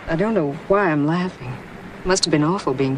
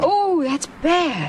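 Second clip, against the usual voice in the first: slow dismayed; 8 semitones higher, much slower than usual. Acted. Playing straight man.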